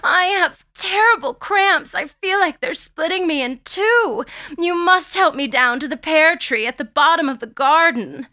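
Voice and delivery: high-pitched pleading in mock agony; small pained voice. A narrator's reading. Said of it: "she says in a small voice".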